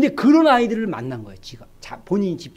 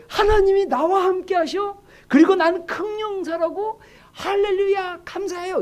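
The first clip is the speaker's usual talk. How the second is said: mock thrilled believer; 8 semitones higher than usual. Ordinary believer answering the angel, mock tearful delight. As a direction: act overcome with joy and gratitude. Acting how we would react, thrilled.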